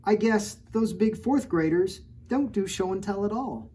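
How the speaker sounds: rhyming picture-book read-aloud; story narration. A narrator's reading. Reading a rhyming storybook to children, lilting. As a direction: read the rhyme to the kids. Reads last page.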